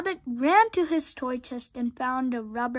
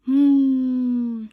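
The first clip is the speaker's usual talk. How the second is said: long droning robot snore hum; snoring hum; 9 dB louder than usual. A narrator's reading. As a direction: hum it out like a sleeping machine. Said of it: "snored Robot".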